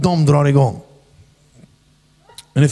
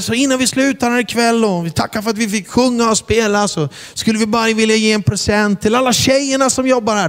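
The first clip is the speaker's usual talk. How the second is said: high announcer voice on the mic; stage announcement; 8 semitones higher than usual. Retold or imitated. Preacher announcing a gift to the club girls. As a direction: address the room like a host. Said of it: "Så sa jag".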